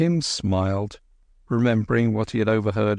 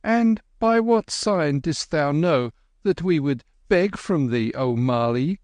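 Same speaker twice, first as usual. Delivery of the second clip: put-on high, tart retort from a boy; tartly; 6 semitones higher than usual. A narrator's reading. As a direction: needle the gardener. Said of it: "said Kim tartly".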